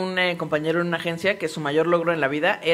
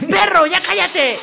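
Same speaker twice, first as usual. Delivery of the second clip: mock yell at the dog; 10 semitones higher, 3 dB louder, slower than usual. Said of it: "Así".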